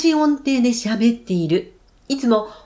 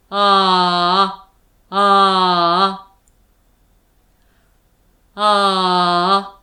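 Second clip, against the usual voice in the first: lower, slow, held ahs; 4 semitones lower, much slower than usual. Shown as a demonstration. Teacher leads the low-voice drill with the class, low and slow. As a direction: sink the pitch and stretch each ah. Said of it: "三回一緒にやってみましょう".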